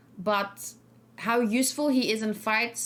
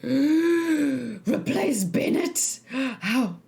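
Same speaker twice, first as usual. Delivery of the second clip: gasp, then a high, drawn-out incredulous question; shocked disbelief; 4 semitones higher, 3 dB louder, much slower than usual. Real, not acted. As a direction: react aghast at the very idea, slowly and dramatically. Mock shock at chat suggestion.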